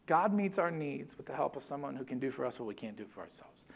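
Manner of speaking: ordinary delivery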